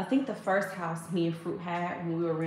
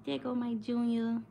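tender coo; 5 semitones higher than usual. Real, not acted. Cooing over sleeping baby.

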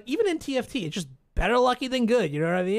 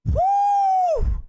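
much higher, louder whoop; celebratory whoop; 5 dB louder than usual. Real, not acted. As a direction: let out a victory woo. Celebrating a win.

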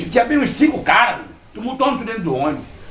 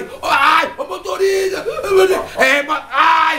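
much higher pained shout to the driver; mock cry for help; 9 semitones higher, much slower than usual. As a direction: wail for the driver like the beaten guy. Thug crying out to driver. (grito de socorro imitado ao motorista)

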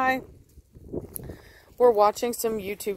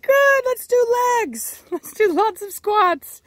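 high, slow chirpy trainer voice; bubbly trainer cheerfully ordering squats; quoting the trainer; 12 semitones higher, 6 dB louder, slower than usual. Retold or imitated. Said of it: "she's like".

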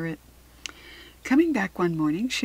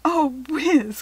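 higher, louder cry of dismay; raised-pitch exclamation to a friend; exclaiming girl; 7 semitones higher, 6 dB louder than usual. A narrator's reading. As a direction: cry out in exasperated surprise. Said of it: "cried Harriet".

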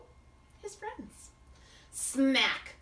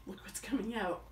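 plain reading voice

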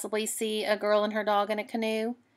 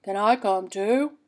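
lightly voiced bear, louder; a big bear asking nicely; 5 dB louder than usual. Bear asking the girl, lightly voiced louder. Bear asks, changed timbre.

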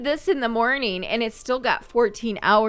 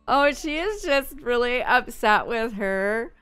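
ordinary talk, nothing marked

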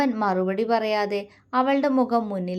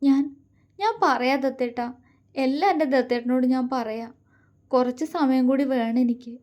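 mildly breaking voice; 4 semitones higher than usual. A narrator's reading. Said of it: "അവളുടെ ശബ്ദമിടറി".

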